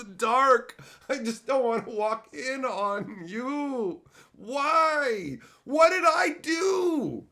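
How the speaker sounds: high, loud comic rant; hammed-up pleading shout; exasperated yelling. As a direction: wail in baffled exasperation, stretching the last words. Acted outburst at the absent stranger.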